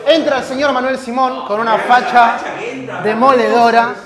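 mock announcer. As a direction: announce a grand entrance, playfully overblown. Presenta la entrada de un jugador. (presentador exagerado anunciando una entrada)

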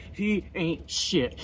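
slow, dismissive bar-guy drawl; lightly voiced barstool critic; imitating bar guy. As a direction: sneer it like a bar loudmouth. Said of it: "they started talking shit about LeBron James".

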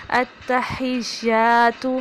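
التحیات slow, shadda held fully, ha crisp; correct recitation. Shown as a demonstration. Teacher demonstrating correct التحیات to students. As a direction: recite التحیات slowly with a full doubled ta. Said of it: "شد کو بھی پورا ادا کریں گے".

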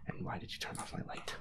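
ordinary talk

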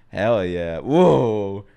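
ordinary delivery

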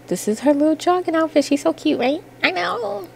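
cooing over cute outfit. Real, not acted. Cooing over her Sim's outfit.

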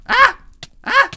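high, loud panicked scream. Real, not acted. Being killed in game.

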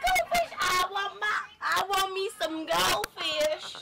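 high, loud, slow drawn-out yell; hammed-up whining demand for goldfish; playful yell. Real, not acted. Kid yelling on the bus.

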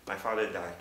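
normal talking voice

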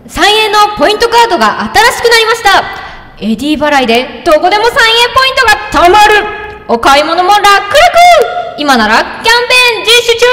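loud high hyped preview narrator; anime preview voice. Acted. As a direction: belt it like an anime next-episode teaser. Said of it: "予告風にしますか？".

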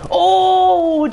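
sudden very high loud gasp; shocked cry. Real, not acted. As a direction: react to a jump scare. Sudden shock seeing enemy.

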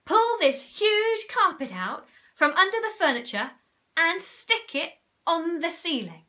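loud, high command; shouted order. A narrator's reading. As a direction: keep barking the plan. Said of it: "shouted Mugglewump".

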